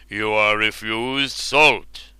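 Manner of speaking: very slow, grave king's verdict; stern refusal. Acted. Ubar refusing the hero bread, very slow and grave. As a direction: pronounce it like a sentence. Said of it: "he said".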